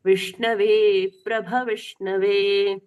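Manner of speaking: slow chant, प्रभ with a full भ; full bha. Shown as a demonstration. Teacher showing the full भ, slow chant. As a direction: sound प्रभ fully before विष्णवे, slowly. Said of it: "पूर्ण भ सुनाई देना चाहिए".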